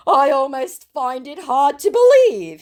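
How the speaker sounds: the scammer faking surprise, high and slow; mock scammer voice. Reading scammer's reply aloud.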